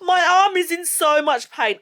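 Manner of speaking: high-pitched complaint; pained whine. Real, not acted. Vlogger telling viewers about her jabbed arm, pained whine.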